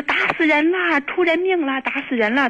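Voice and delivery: high-pitched mock cry for help; retold wailing outcry. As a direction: imitate a hysterical yell without full volume. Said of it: "他就给我这样嚷".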